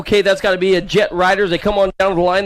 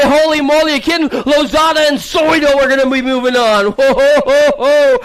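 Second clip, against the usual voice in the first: higher, louder amazed cheering; excited hype; 5 semitones higher, 3 dB louder than usual. Real, not acted. Announcer still hyped after the move of the day. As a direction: keep the thrill going.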